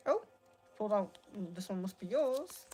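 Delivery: usual speaking voice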